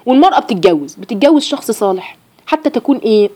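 usual speaking voice

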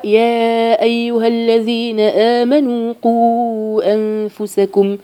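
slow, drawn-out chanted tajweed recitation; measured Quranic chant with long held vowels. Acted. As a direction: intone the verse slowly like a reciter.

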